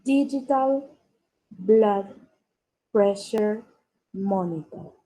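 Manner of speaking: each word set apart by a short pause; slow dictation. Shown as a demonstration. Teacher repeating the phrase for pupils to copy, slow dictation. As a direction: say it word by word, clearly separated. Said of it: "La voy a pronunciar lentamente".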